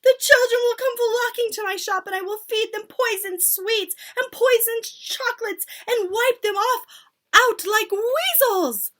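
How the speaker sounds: very high, loud gleeful witch; excited shout. Acted. Foolish witch leaping up with her idea, high gleeful shout. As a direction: shriek with wicked glee. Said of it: "leapt to her feet and shouted".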